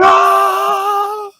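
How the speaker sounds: high mock scream from the commercial; imitated scream. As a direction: let out a high startled shriek. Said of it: "Chris like".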